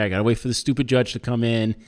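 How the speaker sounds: usual speaking voice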